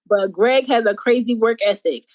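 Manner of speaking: usual speaking voice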